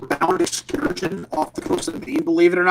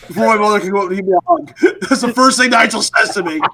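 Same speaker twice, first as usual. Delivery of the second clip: ordinary talk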